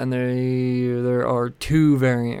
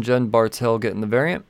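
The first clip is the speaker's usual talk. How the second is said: usual speaking voice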